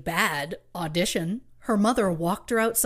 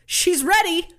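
higher, louder retold shout; mock shout; 9 semitones higher, 5 dB louder than usual. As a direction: call it out like an eager stage mother. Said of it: "and shouts".

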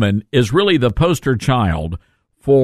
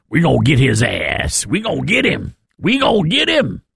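mocking impression of Letitia James. Host parodying the attorney general's threat to sue, mocking imitation. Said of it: "you heard her there".

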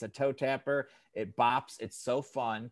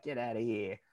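hint of Irish, tossed off quietly; Irish accent; 7 dB quieter than usual. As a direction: try a quick Irish lilt. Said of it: "That's my Irish accent right now".

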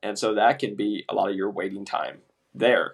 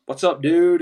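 higher, louder buddy greeting; friendly greeting; 4 semitones higher, 6 dB louder, slower than usual. Retold or imitated. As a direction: greet him like an old pal. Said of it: "then they're like".

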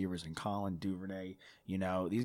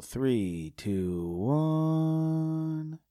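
building countdown, last number higher and louder; 7 semitones higher, 4 dB louder, much slower than usual. Acted. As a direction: count it down into the show. Countdown to show start.

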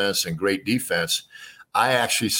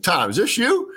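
surprised; 11 semitones higher, 3 dB louder than usual. Retold or imitated. Suspects a prank call.

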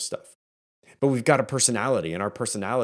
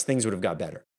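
ordinary delivery

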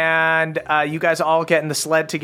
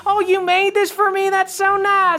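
squeaky-high gushing elf voice; grateful crick elves. Acted. Elves get damaged toys, high and overcome with gratitude. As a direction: gush way up high, moved by the gift. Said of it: "but they're like".

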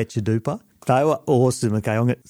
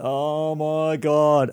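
exasperated sigh; 5 semitones higher, much slower than usual. Retold or imitated. Exasperated reply on the phone.

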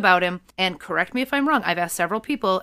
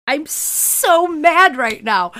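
slow, high, loud angry wail; exaggerated outrage at the show; mad; 4 semitones higher, 5 dB louder, much slower than usual. Real, not acted. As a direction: drag it out furious about the death. Fan ranting about killed character.

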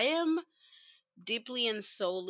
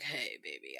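put-on much lower, softer "Hey, baby."; smooth guy voice; 9 dB quieter than usual.

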